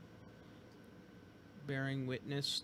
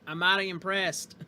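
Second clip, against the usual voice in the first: put-on southern accent; 5 semitones higher, 9 dB louder than usual. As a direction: lay on a Southern accent, impressed. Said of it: "I meant to do that with a southern accent".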